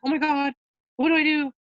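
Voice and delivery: spiral into a customer-service panic; panicked worrying. Retold or imitated. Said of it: "they're like".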